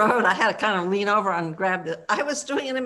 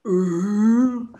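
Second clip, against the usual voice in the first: loud mock grunt of a tennis player; imitated tennis grunt; 7 dB louder than usual. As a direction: grunt like a woman serving at tennis. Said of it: "the grunting that they do".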